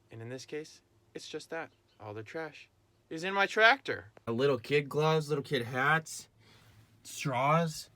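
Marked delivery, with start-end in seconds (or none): 3.1-7.9 loud, high, slow exasperated listing; mock exasperation. Real, not acted. Farmer loudly and highly complaining about the grandkids' trash. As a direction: list the trash in playful outrage.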